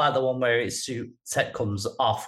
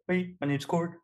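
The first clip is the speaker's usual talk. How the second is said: higher, lightly voiced Kiwi accent; New Zealand accent; 6 semitones higher, 4 dB quieter than usual. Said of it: "his New Zealand accent when he puts it on".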